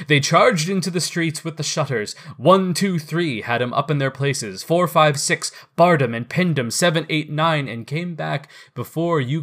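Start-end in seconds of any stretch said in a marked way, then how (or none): none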